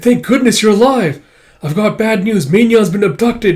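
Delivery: high, loud, anxious report; acted agitated urgency; urgent relief. Urgent relief and bad news.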